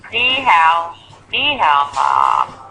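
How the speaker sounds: flat, toneless Ni hao ma with no tones; mock bad Mandarin, slow; deliberately bad Mandarin. Shown as a demonstration. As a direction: say Ni hao ma badly on purpose, all tones flattened. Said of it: "I'm trying to make it bad".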